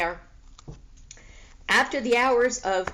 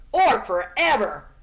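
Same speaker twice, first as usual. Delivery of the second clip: calling after; 6 dB louder than usual. A narrator's reading. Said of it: "Flora called after her".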